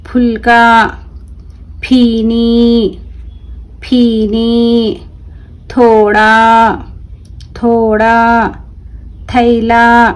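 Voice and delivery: each word drawn out slowly, clearly; careful drawn-out classroom reading; slow word-list reading. Shown as a demonstration. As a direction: read it slowly for children to repeat. Teacher reads word list aloud.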